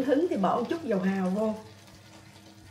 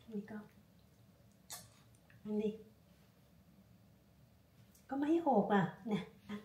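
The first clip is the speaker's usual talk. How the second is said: soft coaxing; 9 dB quieter than usual. Real, not acted. Coaxing dog softly.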